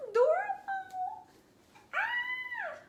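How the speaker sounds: very high delighted squeal. Real, not acted. Painter finishing the face, high squeal of delight. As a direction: squeal it, overcome by cuteness.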